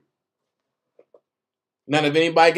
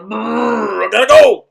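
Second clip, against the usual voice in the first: mock-panicked bail-out from a call; abrupt mock exit; 7 dB louder than usual. Telling how he cut Shan's call short, abrupt and loud. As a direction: burst out loudly after a trilled brrr.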